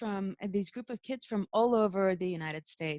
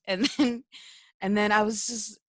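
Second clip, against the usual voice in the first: ordinary talk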